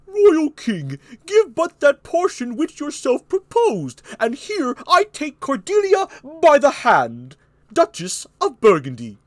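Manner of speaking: high, loud, drawn-out courtly proclamation; Burgundy. Acted. Burgundy bargaining with the king, high loud voice.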